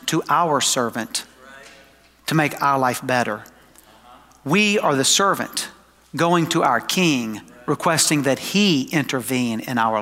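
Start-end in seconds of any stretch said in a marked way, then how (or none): none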